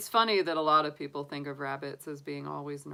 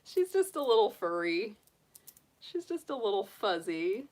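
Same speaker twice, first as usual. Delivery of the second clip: cooing to bunny; 6 semitones higher, 3 dB louder than usual. Real, not acted. Baby talk to her bunny.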